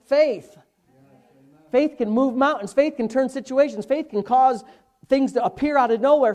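emphatic, driving pulpit delivery; forceful preaching. Real, not acted. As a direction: punch each declaration about faith.

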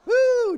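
a loud, high, real whoop of relief; sudden high whoop, half laughing at himself; relieved whoop. Real, not acted. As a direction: let out a big woo after the drive. Exhales after a tough snowy drive.

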